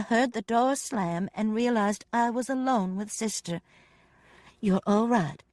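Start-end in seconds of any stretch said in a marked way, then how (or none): none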